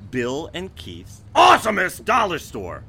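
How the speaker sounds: loud booming announcer read; monster truck ad. Acted. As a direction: blast it out like a truck rally ad. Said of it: "but not like you're saying it".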